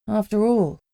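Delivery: ordinary delivery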